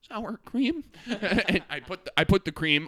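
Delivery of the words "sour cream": high rising disbelief; incredulous. Retold or imitated. To the friend offering sour cream, shocked surprise. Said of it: "And I was like".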